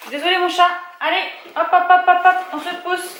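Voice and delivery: high, coaxing pet talk to the cat. Real, not acted. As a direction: shoo the cat gently along with a sing-song hop hop hop. Shooing the cat off.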